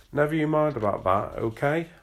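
northern dad butting in on his daughter; Erin's dad. A narrator's reading. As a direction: cut in bossy and cheery. Dad butts in.

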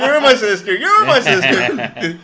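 loud, high, drawn-out movie cry; Joe Dirt. Acted. Friend quoting the sister line as a joke, hammed-up cry. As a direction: wail it, half laughing. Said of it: "I'm thinking of Joe Dirt".

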